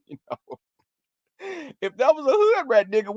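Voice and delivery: normal talking voice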